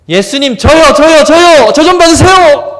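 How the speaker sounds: high, loud pleading cry; desperate calling out. Retold or imitated. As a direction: shout to be noticed over the crowd. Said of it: "했다는 거예요. 계속해서".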